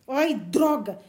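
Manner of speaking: loud frustrated curse; violent outburst. Acted. Barney cursing at Valancy, angry outburst. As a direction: bite it out in anger. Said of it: "disse Barney violentamente".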